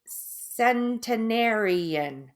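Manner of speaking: "Centenarian" drawn out very slowly, syllable by syllable; careful pronunciation. Shown as a demonstration. Stroke survivor attempting a hard word, careful slow "Centenarian". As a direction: sound out "Centenarian" with great care, stretching each part. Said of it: "So I'm gonna say this, hang on".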